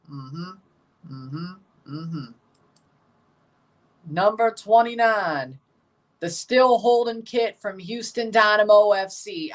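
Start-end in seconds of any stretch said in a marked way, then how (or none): 4.1-9.1 announcer voice. Acted. Announces countdown item.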